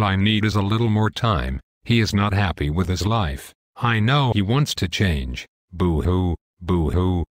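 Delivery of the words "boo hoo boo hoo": lower, sing-song fake sobbing; the devil taunts the angel with fake boo-hoo crying; mock boo-hoo crying. A hammed-up, mocking boo-hoo. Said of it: "I am about to cry".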